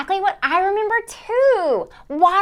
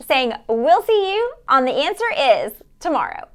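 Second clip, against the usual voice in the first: ordinary talk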